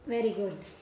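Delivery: a pleased teacher's very good. Real, not acted. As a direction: praise the class gently after the drill. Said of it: "praise after drill".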